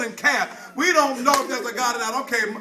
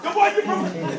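preaching whoop; 6 semitones higher than usual. Acted. Whooping climax of the sermon.

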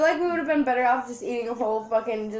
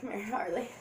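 ordinary talk, nothing marked

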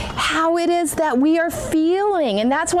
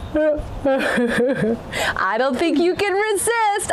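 laughing; much slower than usual. Acted. Yawn demo to children.

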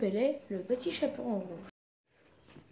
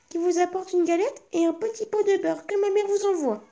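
put-on much higher child's voice; a small girl explaining her errand, much higher; little girl's voice; 8 semitones higher than usual. Girl at the door tells grandmother why she came, high child's voice.

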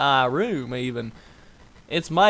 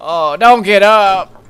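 high, louder, slow don't get up; mock loud plea; 4 semitones higher, 7 dB louder than usual. Shown as a demonstration. Player begging the character not to get up. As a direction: drag it out loud.

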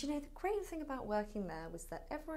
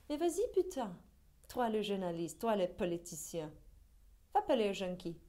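loud, goading French outburst; heated challenge; 5 dB louder than usual. Acted. Challenging the journalist and the politician to go themselves, heated goading. Acted angry challenge to critics.

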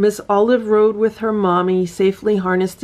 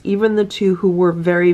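ordinary delivery, nothing marked

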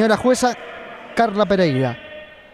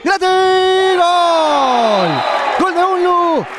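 shouted goal call; 6 semitones higher, much slower than usual. Acted. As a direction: belt out the goal. Goal called in commentary.

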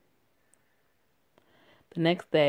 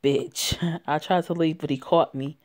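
plain reading voice

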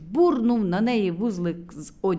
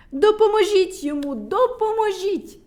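high, loud pleading; begging cry; 9 semitones higher, 6 dB louder than usual. A narrator's reading. Medunytsia begs the others to save Burchun. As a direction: beg for help in panic. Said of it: "благала медуниця".

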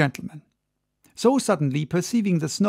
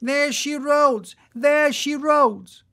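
high, ringing sailor's cry, slower on the repeat; excited shout; 9 semitones higher than usual. A narrator's reading. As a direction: sing out the whale sighting from the chains. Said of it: "all at once shouted out".

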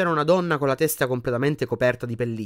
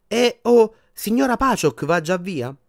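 higher, louder surprised nurse call; healer calling out; 8 semitones higher, 5 dB louder, slower than usual. A narrator's reading. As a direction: call after the visitor brightly. Healer calls to departing visitor. (la guaritrice chiama, voce acuta)

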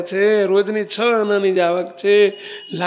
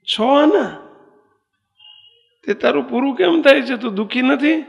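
higher, incredulous question about છ આના; a king's disbelief, pitched up; astonished king; 5 semitones higher than usual. Retold or imitated. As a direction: sound amazed anyone lives on so little. King astonished at tiny income.

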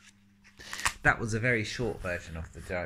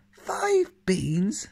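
high, loud indignant mother; cross mother; 11 semitones higher, 6 dB louder than usual. Acted. Jack's mother scolding him over the beans, high and indignant. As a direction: throw it back at him in disbelief. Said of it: "She was cross".

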